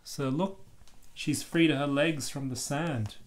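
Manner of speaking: ordinary delivery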